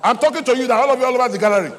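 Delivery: high, loud exhortation to the congregation; full-force preaching. Real, not acted. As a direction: charge the crowd, loud and high.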